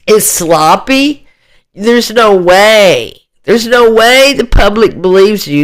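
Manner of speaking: ordinary talk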